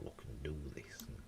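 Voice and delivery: unvoiced whisper to himself; whispered aside. Real, not acted. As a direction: breathe the words under your breath. Muttering to himself while setting up.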